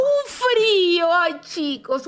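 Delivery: near tears. Real, not acted. Telling the co-host about the freezing hurt dog, near tears. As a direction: let the voice crack on the memory.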